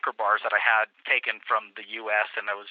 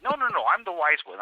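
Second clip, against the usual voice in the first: boasting policeman; 7 semitones higher than usual. Retold or imitated.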